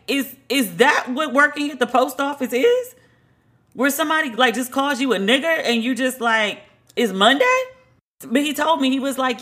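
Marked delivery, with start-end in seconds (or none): 0.1-7.6 high-pitched, baffled questioning; retold incredulity. Teller to postal worker about shrugging off a slur, incredulous. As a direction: push the question up in disbelief.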